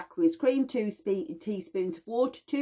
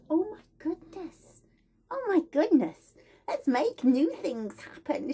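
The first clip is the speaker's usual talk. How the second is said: high, gushing fairy godmother voice, pitched way up; sparkly fairy godmother; 9 semitones higher than usual. Pretend. Herbalist voicing myrtle as a sweet fairy godmother, gushing and high. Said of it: "she turns up in all the sparkles and she goes".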